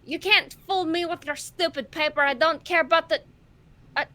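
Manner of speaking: loud defiant protest, pitch climbing; acted indignant outburst. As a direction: fire it back loudly in character. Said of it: "at first she's like".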